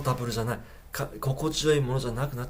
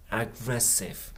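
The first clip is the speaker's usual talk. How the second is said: slow repeat; 4 dB quieter, much slower than usual. Shown as a demonstration. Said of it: "slow repeat of word".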